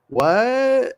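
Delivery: high-pitched feigned surprise; hammed-up, high "oh no" concern; mock dismay. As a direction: play shocked at obviously fake news. Mock dismay at kids' stomach aches.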